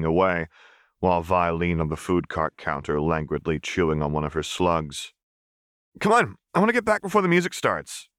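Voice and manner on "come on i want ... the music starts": put-on much higher, fast whiny boy; impatient kid. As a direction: hurry them along. Milo eager to leave.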